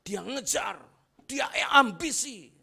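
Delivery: loud, high shouted sermon climb; full-force preaching. Acted. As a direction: preach it to the back row. (khotbah berapi-api dengan suara lantang)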